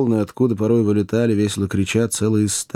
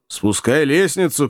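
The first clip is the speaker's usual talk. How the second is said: mild call, pitched up; a lightly raised order; 6 semitones higher than usual. A narrator's reading. Hunter orders the ladder let down, calling out. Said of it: "крикнул охотник".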